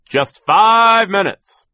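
very loud, high and stretched out; bellowed order. A narrator's reading. Foreman warns workers about short lunch, bellowing. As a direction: roar the time limit at the crew. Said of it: "as he gave orders".

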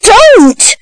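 put-on high, protesting boy's cry; struggling cry. A narrator's reading. Boy struggling in a stranger's arms, high alarmed cry. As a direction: cry it out as if grabbed. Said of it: "cried Oliver, struggling".

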